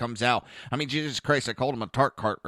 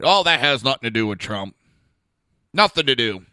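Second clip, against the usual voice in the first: loud mocking voice; sneering mimicry; 9 dB louder than usual. Mocking liberals dismissing Trump's market, loud sneer. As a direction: ham up their denial.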